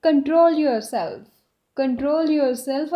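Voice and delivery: "Control yourself" stretched out slowly; very slow demo. Shown as a demonstration. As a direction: drill it slowly twice. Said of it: "English में कैसे बोल सकते हैं". (बहुत धीरे-धीरे)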